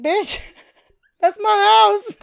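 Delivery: high, loud, drawn-out mock indignation; laughing outburst. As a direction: exclaim it slowly through a laugh. Retold outburst at friend, laughing.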